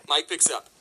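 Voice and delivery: ordinary talk, nothing marked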